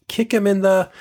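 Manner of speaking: put-on high excited kid shout. Retelling a trailer, imitating a kid shouting. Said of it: "you hear one of the characters shout".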